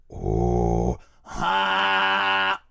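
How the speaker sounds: deep throat-singing growl; ultra low rumble. Shown as a demonstration. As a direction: rumble from the ventricular folds. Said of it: "throat singing ... ventricular fold".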